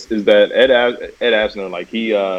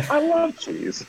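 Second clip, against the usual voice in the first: put-on high, faint old man voice; thin, quavering old man; 9 dB quieter, much slower than usual. Repeating the Ed Asner line.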